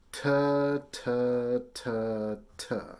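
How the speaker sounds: four separate "ta" syllables, each starting on a firm T; tongue tip behind the teeth for each "ta". Shown as a demonstration. Teacher showing students the T sound, syllable drill on "ta". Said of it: "try to just say".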